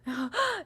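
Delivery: sharp, high audience gasp; imitated gasp. Imitating two people in the theater, gasping. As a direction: mimic a shocked moviegoer gasping. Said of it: "like, gasping. They're like".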